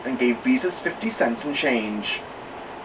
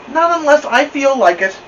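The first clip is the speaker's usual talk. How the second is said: teasing; 14 semitones higher, 7 dB louder than usual. Acted. Teasing sister.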